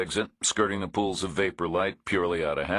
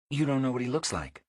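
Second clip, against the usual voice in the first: a dry, wary woman, a touch higher; woman's dry voice; 8 semitones higher, faster than usual. A narrator's reading. Woman complains to Reacher about no signal, dry and higher.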